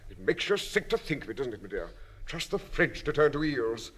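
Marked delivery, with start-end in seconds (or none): none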